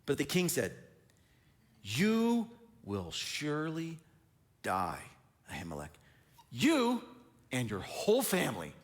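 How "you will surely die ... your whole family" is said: king's death sentence. Acted. King pronounces death sentence.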